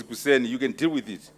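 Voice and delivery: ordinary delivery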